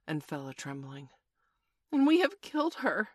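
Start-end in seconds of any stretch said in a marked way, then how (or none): 1.9-3.1 high, loud, anguished cry; hoarse. A narrator's reading. Nibs realising they killed Wendy, hoarse with horror. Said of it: "Nibs said hoarsely".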